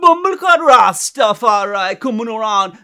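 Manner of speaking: loud, high mock Jamaican rant; fake Jamaican accent. Imitating the fake gangster at the party, loud fake Jamaican accent. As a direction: exaggerate a Jamaican accent, shouting the curse. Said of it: "he had a fake Jamaican accent".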